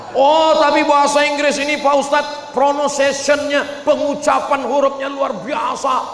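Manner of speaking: high, excited fan voice; an awed villager praising English; gushing admirer. Retold or imitated. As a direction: gush about English, high-pitched.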